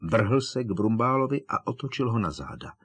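ordinary delivery

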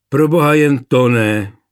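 loud, blurted plea. A narrator's reading. Younger brother cuts into a quarrel to joke, blurting out. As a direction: burst in with mock dread. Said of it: "vyhrkl Jošt".

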